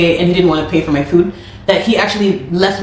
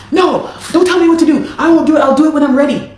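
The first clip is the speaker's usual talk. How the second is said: defiant refusal; 8 semitones higher than usual. Retold or imitated. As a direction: throw his bossy refusal back at her. Manager refuses, turns evil.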